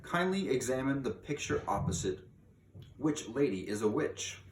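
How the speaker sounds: usual speaking voice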